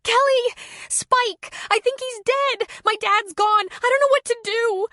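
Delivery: panicked high shriek. A narrator's reading. Girl screaming to Kelly on phone about her dog. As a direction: shriek for help, terrified. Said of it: "Courtney shrieked".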